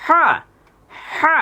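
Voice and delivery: "হা" with a strong breathy pharyngeal h; from the throat. Shown as a demonstration. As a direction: squeeze the throat on "হা". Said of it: "গলায় হাত দেন".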